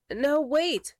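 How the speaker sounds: high, urgent storybook protest; pleading cry. A narrator's reading. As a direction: cry out to stop them. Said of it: "cried Henry".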